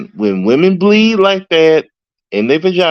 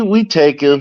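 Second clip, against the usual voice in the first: ordinary talk, nothing marked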